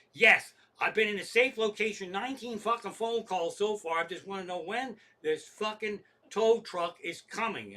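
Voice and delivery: indignant phone complaint at full tilt; exasperated. Retold or imitated. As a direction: fire back, utterly fed up. Retold irate reply to supervisor.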